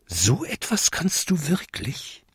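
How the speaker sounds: soft, high little question; goblin asking in wonder. A narrator's reading. Goblin doubts the godmother's magic, wondering. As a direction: ask it small and disbelieving, rising. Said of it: "fragte er".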